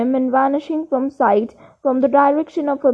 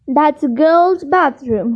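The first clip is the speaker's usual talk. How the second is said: usual speaking voice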